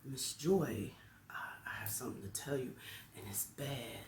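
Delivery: softly voiced child confiding bad news; hushed confession. Child confiding bad news to the teacher, hushed.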